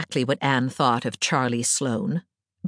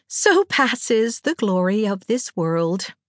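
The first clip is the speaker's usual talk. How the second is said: slow, higher, lightly rueful declaiming; a slow pronouncement with a touch of regret; wistful amusement; 7 semitones higher, slower than usual. A narrator's reading. Anne concludes on her desecrated old shrines, with wistful amusement. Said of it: "with a laugh in which there was a little note of regret".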